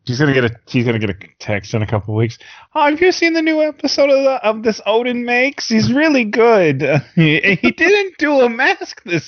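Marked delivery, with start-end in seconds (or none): 2.8-9.3 high, hammed-up New York mom voice; exaggerated New York lady voice; New York accent. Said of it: "spot-on impression of my mom".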